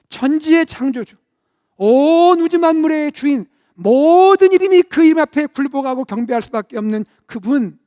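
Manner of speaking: preacher proclaiming God's majesty; majestic proclamation. Acted. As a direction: lift His name up over the room.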